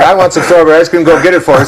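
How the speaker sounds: put-on high, laughing order to the kids; mock bossy parent. Parent sending a kid for ice cream, mock bossy. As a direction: toss off a lazy command, amused.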